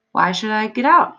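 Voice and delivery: usual speaking voice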